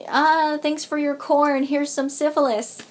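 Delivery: hammed-up fake gratitude from a colonizer; mock colonizer. Mocking European colonizers in school history, hammed-up sarcastic voice. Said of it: "European colonizing this place".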